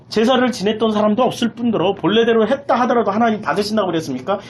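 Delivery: usual speaking voice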